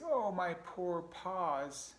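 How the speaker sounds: high, drawn-out mock wail; crying pack rat. A narrator's reading. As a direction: moan slowly over sore paws in a critter voice. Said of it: "wringing his paws and crying".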